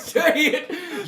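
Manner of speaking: high, loud, laughing yell; playful hollered order; mock demanding yell. Joins the mock bullying of locals.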